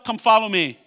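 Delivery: ordinary delivery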